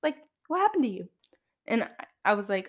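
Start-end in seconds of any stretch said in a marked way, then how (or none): 0.0-1.1 higher, quick imitation of a nosy stranger; imitated blunt question. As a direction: mimic someone asking point-blank, pitched up. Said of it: "just flat out ask me".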